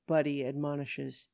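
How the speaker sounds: ordinary delivery, nothing marked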